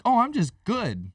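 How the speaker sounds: squeaky high mock brush-off; a hammed-up falsetto "I'm just good"; mocking fake fine. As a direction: pitch it way up, mocking denial. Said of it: "lying to yourself like".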